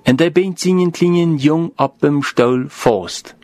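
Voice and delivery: usual speaking voice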